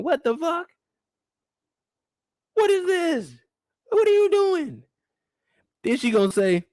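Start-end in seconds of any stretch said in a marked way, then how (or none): 0.0-4.8 high, incredulous exclamations; high-pitched what-are-you-doing disbelief; shocked disbelief. Retold or imitated. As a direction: sound stunned and outraged. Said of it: "I'm like".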